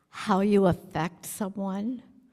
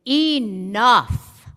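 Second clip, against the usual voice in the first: firm call; 3 semitones higher, 7 dB louder than usual. Shown as a demonstration. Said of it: "people who say".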